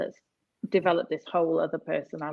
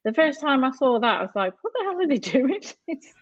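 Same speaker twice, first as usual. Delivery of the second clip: incredulous; 11 semitones higher, 4 dB louder, faster than usual. Retold or imitated. Recalls amazement, laughing at the dips.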